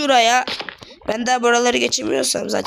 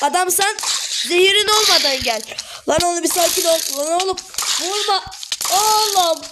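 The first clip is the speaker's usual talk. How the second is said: higher pitched frantic begging; panicked pleading; 5 semitones higher than usual. Real, not acted. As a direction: yelp at the mob to stop hitting. Attacked by zombies again.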